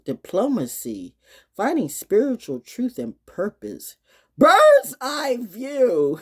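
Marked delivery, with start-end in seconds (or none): 4.4-6.2 loud, high, slowly stretched words; exuberant cry. Acted. Speaker announcing the final phrase, drawn-out high cry. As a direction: call it out, drawing it out. Exclaimed title reading.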